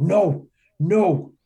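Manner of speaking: loud, high cries of refusal; retold screaming. Man retelling his answer to the inner voice, screaming. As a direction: cry out against it, short of a full scream. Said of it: "started screaming really loud".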